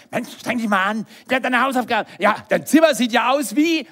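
loud, high mock telling-off; scolding parent. Parent telling off a child about homework and room, scolding loudly. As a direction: nag at full volume, acting exasperated.

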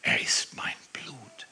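breathless whisper, stunned; whispered shock. Retold or imitated. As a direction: let the words fall away into a whisper. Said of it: "sagt dieser Mann schockiert". (geflüstert, fassungslos)

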